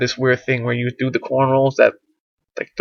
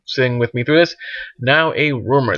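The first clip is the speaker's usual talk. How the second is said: normal talking voice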